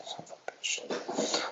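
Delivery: slow, breathless whispered curse; frustrated whisper. Real, not acted. Host hunting for his own tweets, slow whispered curse. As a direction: swear under your breath, annoyed.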